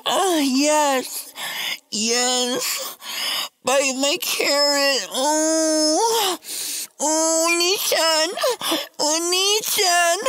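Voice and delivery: high moaning woman voice, hammed-up. Comic storyteller voicing a moaning prostitute, high hammed-up moans. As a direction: moan it high and breathy-free like a mock lover.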